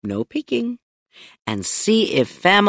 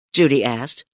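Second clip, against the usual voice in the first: usual speaking voice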